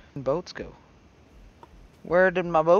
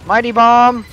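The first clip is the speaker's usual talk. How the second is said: high, loud war call; commanding battle shout; 8 semitones higher, 7 dB louder than usual. Real, not acted. Losing gamer ordering a bomb strike, commanding high shout. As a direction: yell the orders out.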